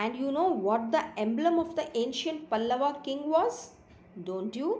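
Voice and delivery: slow, lilting storybook reading; story read-aloud. A narrator's reading. Teacher reading a kitten story to pupils, slow expressive narration. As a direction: read slowly with emphatic pitch swoops on key words.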